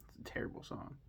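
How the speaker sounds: whispered verdict. Real, not acted. Aside verdict.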